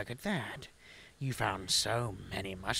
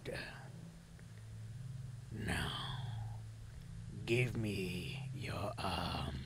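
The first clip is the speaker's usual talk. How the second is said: whisper; 4 semitones lower, 10 dB quieter than usual. Acted. Grandma voice whispering requests.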